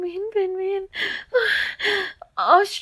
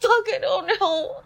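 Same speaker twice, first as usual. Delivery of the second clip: ordinary delivery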